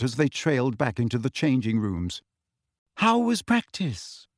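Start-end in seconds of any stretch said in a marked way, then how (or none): 3.0-4.3 high, slow, cool inquiry; rather cool. A narrator's reading. Girl to friends back from practice, rather cool. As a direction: ask with a touch of frost. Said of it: "asked Hermione rather coolly".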